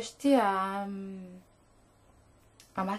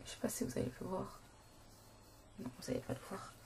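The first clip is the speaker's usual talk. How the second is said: muttering; 3 semitones lower, 16 dB quieter, much faster than usual. Real, not acted. Muttering to herself.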